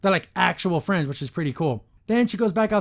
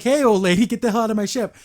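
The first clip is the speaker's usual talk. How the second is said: put-on louder, raised voice shoving an old lady off the ship; half-hearted yell at an intruder; a mild mock shout, higher and louder; mock angry yell; 5 semitones higher, 5 dB louder than usual. Mara shooing old lady off.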